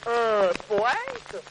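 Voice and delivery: "poète" drawn out in a different, astonished voice; surprised echo. Acted. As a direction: repeat "poète" as if struck. Saint-Pierre reacts to poet.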